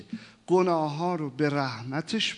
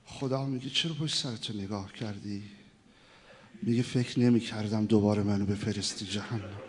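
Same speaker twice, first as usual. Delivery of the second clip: softer, lower intimate telling; hushed storytelling; 5 semitones lower, 4 dB quieter than usual. Acted. Preacher telling the sinner's tale, softly. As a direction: draw the listeners in, quietly.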